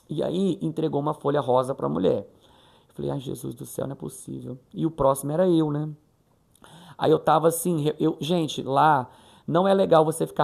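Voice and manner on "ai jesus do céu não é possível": barely audible, under her breath in dismay; softly aghast, almost swallowed; dismayed mutter to herself. Retold or imitated. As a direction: mutter it so nobody near hears.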